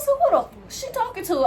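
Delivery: high, incredulous retold question; stunned disbelief. To herself, hearing the coworker, high disbelief. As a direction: act baffled at who she's talking to. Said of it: "I told myself".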